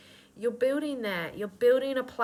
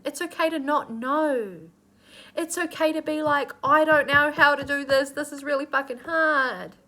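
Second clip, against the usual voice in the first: emotional; 6 semitones higher, 7 dB louder than usual. Real, not acted. Emotional, building loud.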